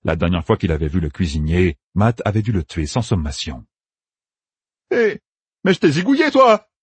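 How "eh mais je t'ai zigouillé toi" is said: much higher, louder startled cry; exclaiming cry. A narrator's reading. Matt yells at the cook he killed, loud exclamation. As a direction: cry out in disbelief. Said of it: "s'écria le jeune flambeur".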